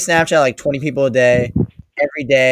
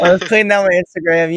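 higher, mocking echo of the excuse; mocking repeat; 6 semitones higher, 4 dB louder, faster than usual. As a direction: parrot the lame excuse.

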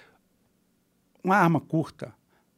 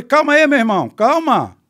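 high, loud re-enacted shout; urgent calling out; 9 semitones higher, 8 dB louder than usual. Driver calls to the men shooting, urgent shout. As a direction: call out to halt them, raised and urgent. Reencena grito aos atiradores.

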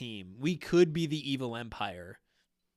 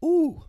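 reaction to strong liquor; 10 dB louder than usual. Real, not acted. Reaction to a Malort shot.

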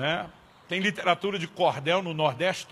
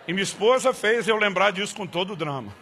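normal talking voice

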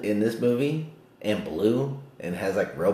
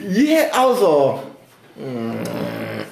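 mock reaction; 2 semitones higher, 6 dB louder, much slower than usual.

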